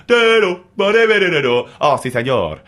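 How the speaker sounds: high, loud hammed-up Spanish singer; Spanish accent, played for laughs; mock Spanish accent. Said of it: "that's my best Spanish accent".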